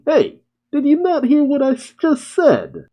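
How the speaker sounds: high, mocking put-on voice; dismissive Crow. Villain mocking the girl who attacks him, dismissive high voice. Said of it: "Crow just says dismissively".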